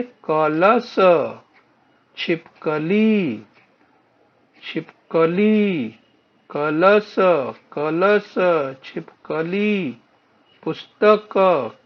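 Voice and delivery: "कलश", "छिपकली", "पुस्तक" drawn out syllable by syllable; slow teaching pronunciation. Shown as a demonstration. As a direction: model each word slowly for repetition, as in "छिपकली" with a long "ली". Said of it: "उच्चारण करके देखिए".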